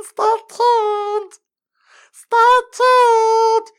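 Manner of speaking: very high falsetto mock announcer; hammed-up promo teaser. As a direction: squeal the cliffhanger like a cheesy ad. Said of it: "There's two of like".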